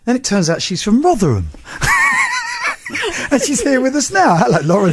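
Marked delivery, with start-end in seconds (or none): 1.5-3.3 high burst of real laughter; a sudden, delighted real laugh; big laugh. Real, not acted. Host laughs at Rotherham reveal.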